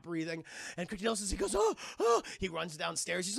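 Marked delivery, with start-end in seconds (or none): 1.6-2.2 high, loud imitated gasping oh; panicked cries. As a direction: gasp out two shocked cries. Father gasps seeing family.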